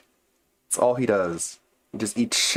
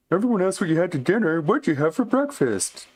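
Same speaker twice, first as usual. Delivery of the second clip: mocking whine; 9 semitones higher than usual. Mocking the fan's question.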